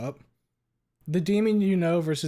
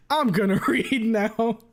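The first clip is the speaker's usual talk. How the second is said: higher, louder, slowed by laughter; laughing; 9 semitones higher, 5 dB louder, slower than usual. Real, not acted. Streamer trying to start reading the fic, cracking up. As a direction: fight through giggles to get it out.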